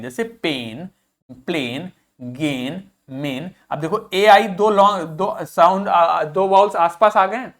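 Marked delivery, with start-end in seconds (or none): 0.2-3.5 पेन, प्लेन, गेन, मेन with long "ay", low and soft; long vowel sound. Shown as a demonstration. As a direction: list पेन to मेन one by one, vowels held. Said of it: "long साउ-, sound बनाते हैं".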